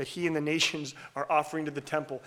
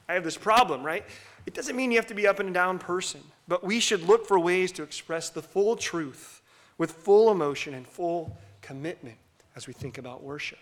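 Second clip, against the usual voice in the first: ordinary talk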